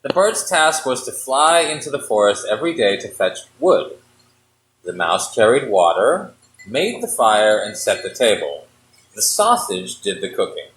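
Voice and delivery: low, measured fairy-tale reading; story narration. A narrator's reading. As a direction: read it like a bedtime story.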